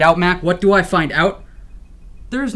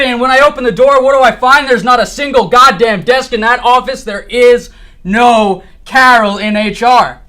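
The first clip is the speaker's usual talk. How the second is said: loud, frantic and wild-eyed; manic yelling; 6 semitones higher, 10 dB louder than usual. Acted. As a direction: yell it like a conspiracy nut. Manic revelation rant.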